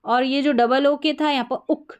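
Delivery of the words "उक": "उक" with a short, quick u; short oo demo. Shown as a demonstration. Teacher reminds learners of double-o-k, a short u in "उक". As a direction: cut the vowel short in "उक". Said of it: "short था double ओ का sound".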